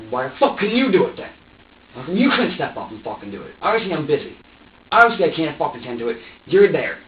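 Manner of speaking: loud, indignant comeback; angry confrontation. Retold or imitated. Worker confronting someone who won't help, angry. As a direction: throw the blame back, loudly. Acted quarrel over work at home.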